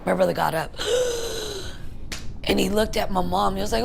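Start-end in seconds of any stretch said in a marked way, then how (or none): 0.8-2.4 long, high sudden gasp; imitated gasp. Brother suddenly getting up after being hit, imitated gasp. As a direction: gasp as if jolting back to life.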